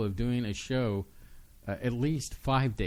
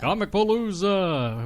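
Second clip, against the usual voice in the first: higher, louder, slow big announcer call; 8 semitones higher, 6 dB louder, slower than usual. Shown as a demonstration. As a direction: hype it like an event announcer. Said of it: "let me do this right".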